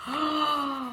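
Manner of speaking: long storytime gasp; mock surprised gasp. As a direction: gasp in wonder for the children.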